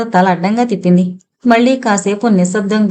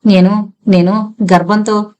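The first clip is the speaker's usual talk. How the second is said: slow, halting admission; understated, hesitant confession of shame; faltering confession. A narrator's reading. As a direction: falter and stop, barely able to go on. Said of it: "తర్వాత మాట్లాడలేకపోయింది".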